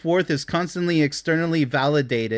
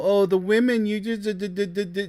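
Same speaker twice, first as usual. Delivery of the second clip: higher, stammering whine of a complaining guy; mock whiny guy; 4 semitones higher than usual. Mocking men who blame women, whiny stammering imitation. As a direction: sneer at him by imitating his stutter.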